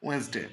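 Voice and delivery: ওয়েডনেসডে pronounced "wens-day", no first d; two-syllable "wens-day" for ওয়েডনেসডে; silent-d Wednesday. Shown as a demonstration. Teacher giving students the correct pronunciation, ওয়েডনেসডে as "wens-day". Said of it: "সঠিক উচ্চারণটা হয়".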